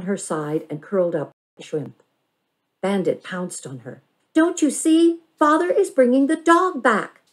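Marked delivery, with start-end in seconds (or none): 4.3-7.2 much higher and louder; excited reveal. A narrator's reading. Bandit pounces on her cousin, bursting with the news. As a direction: burst out with the answer.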